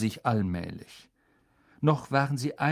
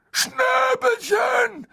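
very high, loud, drawn-out cry; anguished high call; 6 dB louder, much slower than usual. Acted. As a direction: wail the pet's name in a shout. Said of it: "rief Hagrid".

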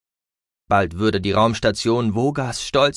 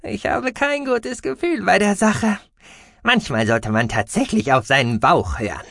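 high squeaky mouse voice, uneasy; uneasy little alien squeak; squeaky creature voice; 10 semitones higher than usual. A narrator's reading. Gucki the mouse-beaver speaks.

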